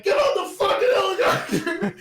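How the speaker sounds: high, loud hammed-up command; mock-furious shout breaking into laughter; imitated furious yell. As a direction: bellow it like a raging movie villain. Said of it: "He's yelling furiously".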